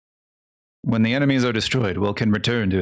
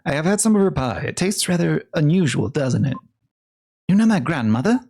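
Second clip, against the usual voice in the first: put-on higher, quicker quaint old lady; old woman voice; 6 semitones higher than usual. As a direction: play a prim old lady, pitched up. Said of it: "I don't know what voice to give her".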